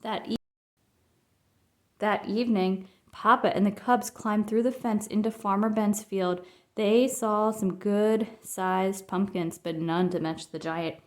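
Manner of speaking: plain reading voice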